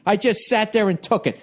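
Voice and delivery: usual speaking voice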